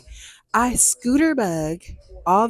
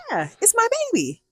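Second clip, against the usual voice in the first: much higher, fast and gushing; proud car owner; 13 semitones higher, faster than usual. Retold or imitated. To the neighbor about her new car, a high fast gush. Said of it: "And I'm like".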